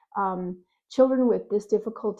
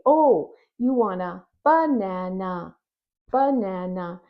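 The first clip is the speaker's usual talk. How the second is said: slow, louder "banana" with all three syllables; clear modeled banana; 4 dB louder, slower than usual. Pretend. Parent modeling the full "banana" for the child. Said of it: "then you can say".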